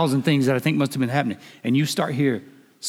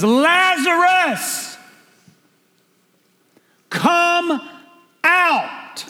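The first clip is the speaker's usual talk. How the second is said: much higher, very slow, drawn-out shout; commanding roar; 5 dB louder, much slower than usual. Shown as a demonstration. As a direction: roar it like the Lion of Judah. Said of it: "spoke the universe into creation says".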